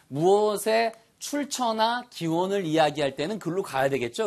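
ordinary talk, nothing marked

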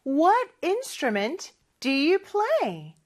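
slow, clear teacher read; carefully spaced demo line; slow model sentence. Shown as a demonstration. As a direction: say it slowly for learners. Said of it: "우리 처음부터 한번 볼게요".

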